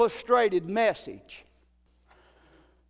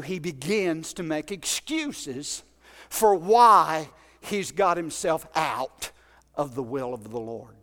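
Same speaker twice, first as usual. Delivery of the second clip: ordinary talk, nothing marked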